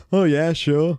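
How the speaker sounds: high, loud Beatle impression; [name]. Co-host answering as the pretend guest, mock Beatles accent. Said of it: "How you doing, Paul?".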